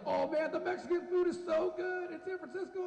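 high-pitched gushing food fan; mock gushing. Tourist raving about San Francisco Mexican food, mock gushing. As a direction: imitate an overexcited tourist raving.

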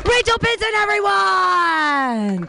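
long drawn-out shout; host shout. Real, not acted. Host introducing the comedian, drawn-out shout. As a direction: stretch the name out to the crowd.

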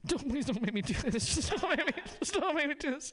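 high, pleading plea in a put-on voice; pleading lady. Deli lady begging the customer to stop, high-voiced and pleading. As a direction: beg him in a high, desperate voice. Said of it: "she looks back up at him like".

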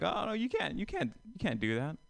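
lightly voiced passenger, high and a little soft; imitated protesting passenger. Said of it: "they'll be like".

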